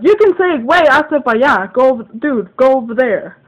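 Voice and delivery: loud, high, heated instruction, retold; exasperated retort. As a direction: spell it out loudly, reenacting the fight. Acting out the quarrel.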